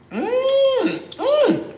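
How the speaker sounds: high sung mmm of pleasure; savoring hum. Real, not acted. Cook savoring gravy for viewers, high pleased hum. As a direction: hum high like it's heaven.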